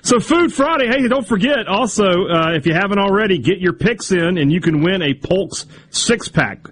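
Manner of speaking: upbeat high promo read. Acted. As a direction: sell the contest like an ad.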